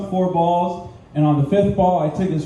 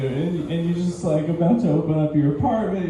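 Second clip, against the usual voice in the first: normal talking voice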